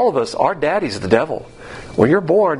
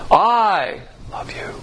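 long, higher "I", then a hushed "love you"; stress on I; 6 semitones higher, much slower than usual. Shown as a demonstration. Preacher voicing the love of God, stress on I. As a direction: lean on "I", let "love you" drop to a whisper. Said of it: "The love of God basically was".

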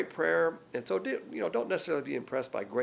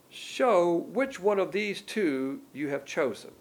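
slow, higher-pitched prayer reading; declaimed prayer; 5 semitones higher, 4 dB louder, slower than usual. Acted. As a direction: draw the plea out as a formal prayer. Reads Peter's prayer aloud.

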